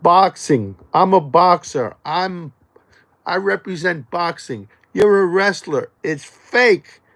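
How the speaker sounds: cocky boxer mocking a wrestler; taunting wrestlers. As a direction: brag at a wrestler like you're squaring up. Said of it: "you know what I say to the wrestlers".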